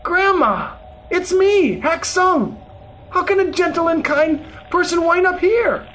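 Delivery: high, urgent calling out; astonished shout. A narrator's reading. Shouting to grandmother in hell, astonished and urgent. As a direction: call out in disbelief, pitch way up. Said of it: "I shouted out to her".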